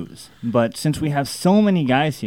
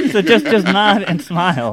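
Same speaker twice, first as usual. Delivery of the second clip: laughing; 7 semitones higher, 5 dB louder than usual. Real, not acted. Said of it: "I can't hear you".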